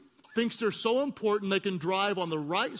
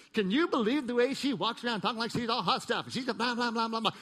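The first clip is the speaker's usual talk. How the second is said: mock gossip; 2 semitones higher than usual. Mimicking gossip about others.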